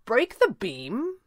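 high, slow puzzled question; feigned incomprehension, drawn out; playing dumb. A narrator's reading. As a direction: echo it as if clueless, rising at the end. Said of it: "elected to play dumb".

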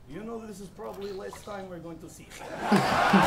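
low, heavy mock Russian accent, dad voice; a rapper mocking his opponent's father with a low Russian accent. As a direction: play the Russian dad saying goodbye in a thick accent. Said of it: "That accent was good, eh?".

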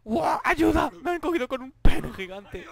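high, loud mock-panicked cries; panicked shouting for help. Real, not acted. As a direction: yell for help while half laughing. Atrapado por el monstruo, gritando. (gritos de auxilio, agudos y fuertes, medio riendo)

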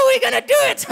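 a wild puppet wolf yelling; high puppet voice. Acted.

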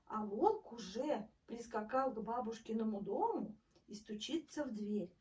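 ordinary delivery, nothing marked

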